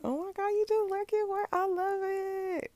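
squealing, very high and gushing; gushing excitement. Retold or imitated. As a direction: gush over it like a thrilled fan. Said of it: "And I'd be like".

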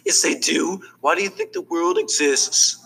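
high-pitched protest; protesting cartoon character. Character arguing that customers matter, protesting.

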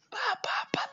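whispered pops like falling bombs. Acted. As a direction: tap it out under your breath. Bombarded with mitzvot.

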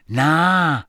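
drawn-out, mildly gloomy dismissal; a touch of gloom in the refusal. A narrator's reading. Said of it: "said George gloomily".

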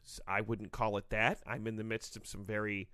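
low, formal official voice; Gary Bettman. Acted. League commissioner greeting podcast hosts, low and formal. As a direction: speak stiffly, pitched well below your own. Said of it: "N H L Commissioner Gary Bettman".

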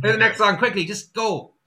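urgent, hurried stage panic; panicky rush. Retold or imitated. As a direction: blurt it out in a panic. Panicked musician during silence at gig.

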